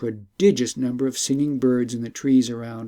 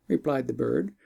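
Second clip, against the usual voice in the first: plain reading voice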